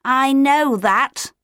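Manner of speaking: high, slow, drawn-out grumble; bitter complaint. A narrator's reading. Otto to Leo about his father, complaining bitterly. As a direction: drag it out as a bitter protest. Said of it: "Otto complained bitterly".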